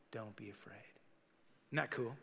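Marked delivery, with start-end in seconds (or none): 0.1-0.8 soft, low, tender comfort; gentle reassurance. Acted. Jesus comforting a kneeling Peter, soft and low. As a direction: speak softly to calm a frightened man.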